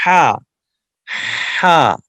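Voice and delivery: ہا pushed out loud from the throat; clear throat ha. Shown as a demonstration. Teacher shows students the ha sound, loud. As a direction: breathe a strong ہا from the throat. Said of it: "ہا کو clear نکالیں گے گلے سے".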